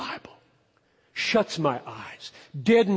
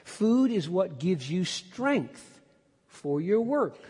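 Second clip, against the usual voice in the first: ordinary talk, nothing marked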